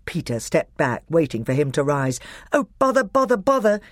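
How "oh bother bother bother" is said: high-pitched fretting to oneself; exasperated self-talk. A narrator's reading. Edmund to himself as Peter waits, exasperated. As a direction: fret at the hold-up. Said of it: "said Edmund to himself".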